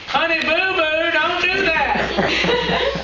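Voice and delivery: high, slow, drawn-out Southern accent with a laugh; hammed-up Southern drawl for the class; playful Southern mom accent. Shown as a demonstration. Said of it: "We talk like this, guys".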